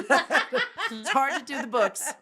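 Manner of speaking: high laughing punchline. Real, not acted. Joking about her jailed bookkeeper, laughing. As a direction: deliver the joke while laughing.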